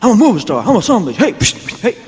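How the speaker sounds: young star showing off in the back seat, hammed-up brag; cocky boasting. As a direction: crow it like a new movie star lounging in a Cadillac.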